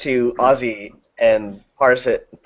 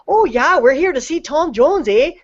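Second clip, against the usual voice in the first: much higher, singsong Canadian woman; imitated Canadian accent; 13 semitones higher than usual. Man retelling a couple at the [name] concert, Canadian accent. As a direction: mimic a Canadian concertgoer, pitched way up. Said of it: "everything you said was Canada".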